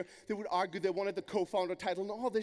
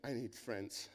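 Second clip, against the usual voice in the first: much softer and lower, a small confession; sheepish plea; 11 semitones lower, 12 dB quieter, slower than usual. Retold or imitated. Newcomer on the mic at an event, softer sheepish plea. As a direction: say it like a lonely newcomer.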